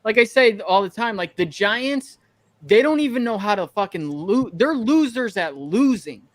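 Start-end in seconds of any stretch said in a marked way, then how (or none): none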